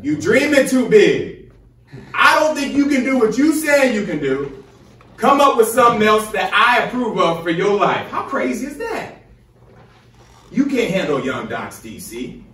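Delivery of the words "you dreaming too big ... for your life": higher, louder mock of a scornful adviser; dismissive doubter. Mimicking advisers telling him to quit, mocking loud scorn. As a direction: sneer it out like the people who doubted you.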